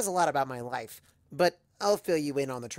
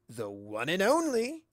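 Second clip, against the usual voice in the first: slow showman's self-introduction; grand Discord; 4 semitones higher, slower than usual. Acted. Discord grandly introduces himself to the ponies. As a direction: present himself like a star. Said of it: "he replies".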